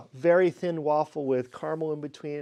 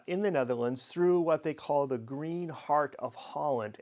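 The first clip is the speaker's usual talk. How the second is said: ordinary delivery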